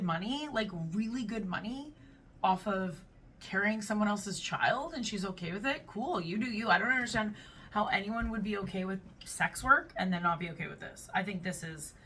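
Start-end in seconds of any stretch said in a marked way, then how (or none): none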